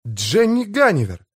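high-pitched exclamation of surprise; astonished gasp. A narrator's reading. Tom recognises the airship, gasping in surprise. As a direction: gasp the name out, pitch leaping up. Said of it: "ахнул Том".

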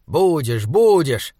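irritated. A narrator's reading. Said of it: "раздражённо сказал Саймон".

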